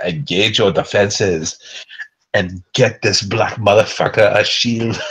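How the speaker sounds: slow mock African accent shout; hammed-up fake African accent, slowly; Chadwick Boseman African accent. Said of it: "you gotta do the fake Chadwick Boseman African, uh, accent".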